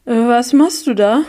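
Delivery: nervous, high-pitched blurt; voice oddly high. A narrator's reading. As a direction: voice jumps up in alarm. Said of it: "Meine Stimme klang merkwürdig hoch".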